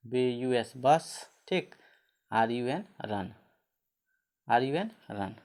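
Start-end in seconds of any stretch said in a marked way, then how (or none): 0.1-1.3 B U S, letter by letter, very slow, then बस; very slow spelling. Shown as a demonstration. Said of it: "अ।". (बहुत धीरे अक्षर-अक्षर बोलकर)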